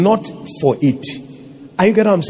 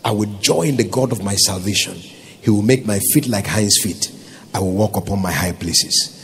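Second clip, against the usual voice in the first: scripture reading; 9 semitones lower, 4 dB quieter than usual. Acted. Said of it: "He said".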